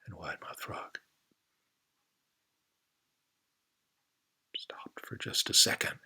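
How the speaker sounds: hushed, paused narration; suspense whisper. A narrator's reading. Storyteller building suspense, whispered. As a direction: hold the tension in a whisper.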